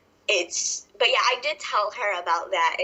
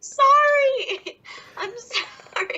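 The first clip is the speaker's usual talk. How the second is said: high squeaky sobbing sorry; hammed-up teary apology; mock whiny apology; 3 dB louder, much slower than usual. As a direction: whimper it like a scolded child. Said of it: "And I'm like".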